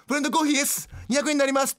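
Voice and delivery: high register announcement; shrill service voice. Acted. Mother tells unrecognized child the price, high-pitched. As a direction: call the price in a high staff voice. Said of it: "すごい甲高い声で".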